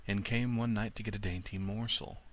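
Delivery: ordinary delivery, nothing marked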